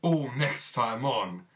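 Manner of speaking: slow, loud villain announcement; menacing outro, drawn out; slow theatrical villain menace. Acted.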